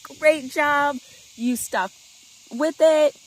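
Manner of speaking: super encouraging. Shown as a demonstration. Parent praising child who succeeded, super encouraging. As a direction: cheer them on with punchy, proud praise. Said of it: "Just being super encouraging".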